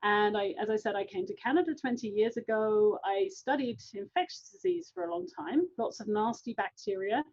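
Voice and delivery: ordinary talk, nothing marked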